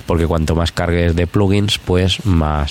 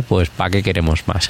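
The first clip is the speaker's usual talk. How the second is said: ordinary talk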